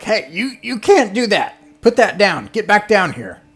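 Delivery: put-on shrill little guards ordering a kid down; scolding goblins. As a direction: goblins stopping someone tearing a curtain. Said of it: "The goblins with the spectacles are like".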